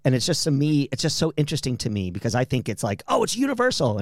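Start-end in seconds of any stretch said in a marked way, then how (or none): 3.1-4.0 high-pitched quoted assumption; lightly acted, high-voiced exclamation; mock naive assumption. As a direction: voice a naive belief, pitched well up. Voicing a common assumption.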